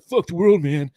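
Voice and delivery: imitated angry kid outburst; mock teen defiance. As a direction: play the snot-nosed rebel. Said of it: "You're just like".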